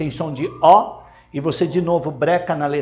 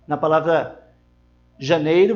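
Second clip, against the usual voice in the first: ordinary talk, nothing marked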